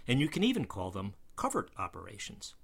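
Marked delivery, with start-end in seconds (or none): 1.4-2.5 "covert" said as KUV-ert; cover vowel. Shown as a demonstration. Offering the traditional pronunciation, cover vowel. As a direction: give "covert" the short uh of cover. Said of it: "with the same vowel that's in our word cover".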